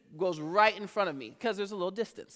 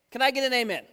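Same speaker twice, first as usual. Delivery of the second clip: usual speaking voice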